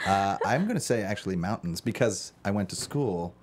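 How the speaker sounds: normal talking voice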